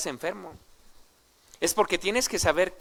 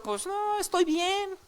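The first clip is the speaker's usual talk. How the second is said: mock dismissive reply; slower than usual. Paralytic imagined brushing Jesus off.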